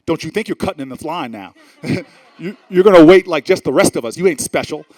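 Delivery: loud, indignant scolding of a line-cutter; angry scolding. Retold or imitated. Woman in the polling line scolding the mayor, loud indignant telling-off. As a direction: tell him off loudly, you won't be fooled. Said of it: "just angrily sort of looks at me and goes".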